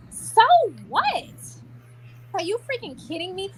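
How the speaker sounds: very high-pitched disbelief; incredulous outburst. Real, not acted. Woman reacting to her jealous family, incredulous outburst. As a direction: exclaim it high, utterly incredulous.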